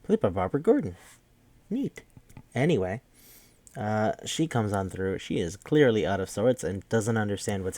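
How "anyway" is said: normal talking voice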